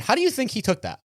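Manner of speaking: normal talking voice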